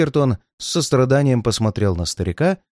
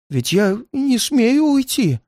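put-on high, slow, pleading old man; tearful, frightened whine; near tears; 11 semitones higher, slower than usual. A narrator's reading. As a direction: plead as if about to cry. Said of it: "чуть не заплакал он".

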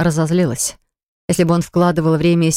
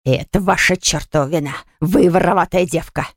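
gruff accusation, held back in volume; mild angry yell. A narrator's reading. Malcolm blaming Polly for everything, understated angry yell. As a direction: accuse with restrained rage. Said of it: "Малкольм продолжал орать".

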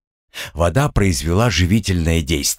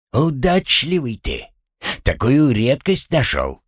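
admiring gremlin; 5 semitones higher than usual. A narrator's reading.

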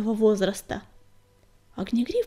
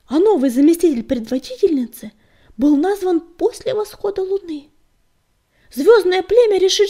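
much higher, louder anxious tirade; alarmed; 7 semitones higher, 9 dB louder than usual. Acted. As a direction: pour out panicked dread. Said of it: "в голосе её звучала такая тревога".

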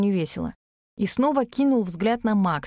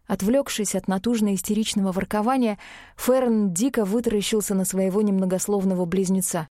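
plain reading voice

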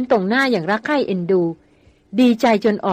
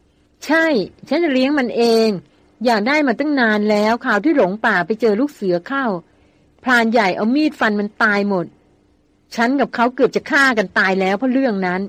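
usual speaking voice

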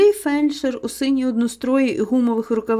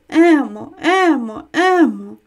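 desperate calls, moderately raised; narrated shouting; 3 semitones higher, 5 dB louder, slower than usual. A narrator's reading. Charlie calling to dying Ema, contained desperate shout. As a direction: call the name out, each one separate. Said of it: "Чарлі почав кричати".

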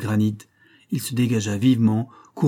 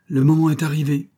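short, clipped and higher, louder; curt voice; 5 semitones higher, 5 dB louder than usual. A narrator's reading. Commander to his sailors, curt and brief. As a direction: bite off the words like an order. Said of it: "dit-il d'une voix brève".

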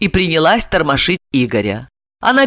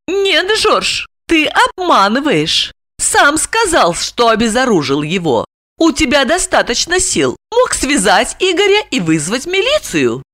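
high female character voice; woman doubting his story; Olga; 7 semitones higher than usual. A narrator's reading. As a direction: reproach him in a higher pitch.

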